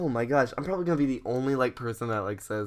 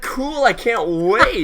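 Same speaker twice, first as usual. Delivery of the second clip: high, louder, drawn-out eagerness; feigned enthusiasm; 8 semitones higher, 6 dB louder, slower than usual. Retold or imitated. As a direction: overdo being thrilled to hide disappointment. Retelling feigned enthusiasm to caller.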